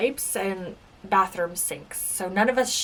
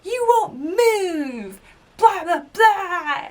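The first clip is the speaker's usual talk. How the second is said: high, loud, drawn-out mimicry of nagging; mock shouting; 11 semitones higher, 6 dB louder, slower than usual. Girl shouting at her to join the team, mock shout. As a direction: imitate a yelling teammate, stretching the words mockingly. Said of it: "shouting at me".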